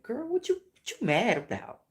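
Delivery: put-on high, teasing girl-what-you-mad-about voice; sassy self-talk. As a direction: scold yourself playfully like a sassy friend. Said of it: "my logic was like".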